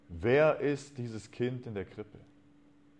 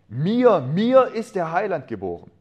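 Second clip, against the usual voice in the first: high, ringing exclamation; jubilant heart's cry; 8 semitones higher, 8 dB louder than usual. A narrator's reading. Man overjoyed that the Saviour is born for him, jubilant cry. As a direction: cry it out overjoyed, leaning on each "Mir". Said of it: "rief mein Herz unablässig".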